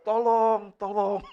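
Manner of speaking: high, loud imitated call for help; mock cry for help. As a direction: play the trapped drunk shouting for help. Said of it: "Teriak-teriak".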